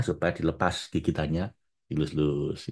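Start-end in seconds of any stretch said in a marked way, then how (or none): 1.9-2.6 softer, lower and tender. Retold or imitated. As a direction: say it like stroking a baby. Tender stroking of baby.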